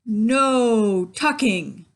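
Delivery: slow, loud chorus of pirates; crew yelling. A narrator's reading. Pirate crew yells refusal at the boy. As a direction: yell it like a rowdy crew. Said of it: "yelled the crew".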